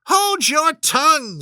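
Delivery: high, loud, different voice; an enraged queen silencing her; furious queen. A narrator's reading. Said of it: "turning purple".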